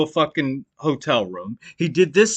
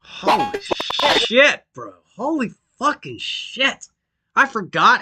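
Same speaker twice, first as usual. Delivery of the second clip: shocked; 7 semitones higher than usual. Real, not acted. Reacting to the rage clip.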